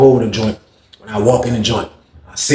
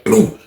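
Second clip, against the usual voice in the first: boom; 10 semitones higher than usual. Retold or imitated. Door bursting open.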